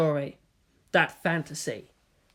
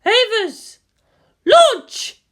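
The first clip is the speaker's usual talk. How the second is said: high, very loud battle cry; launch shout; 10 dB louder, much slower than usual. A narrator's reading. Misato ordering the Evas out, full shout. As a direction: throw everything into the order.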